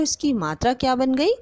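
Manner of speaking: normal talking voice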